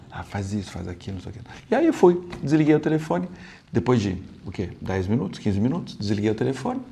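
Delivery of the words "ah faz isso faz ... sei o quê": very soft murmured prompting from the side; feed him lines in a low hush; quiet prompting. Retold or imitated. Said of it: "ela meditava".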